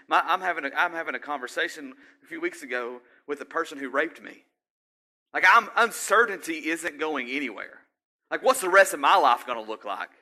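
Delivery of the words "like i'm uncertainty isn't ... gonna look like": loud, higher, worried outburst; acted freaking out. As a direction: voice mild panic about the future. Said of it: "I'm freaking out".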